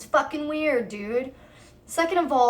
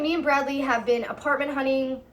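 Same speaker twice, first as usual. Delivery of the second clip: ordinary talk, nothing marked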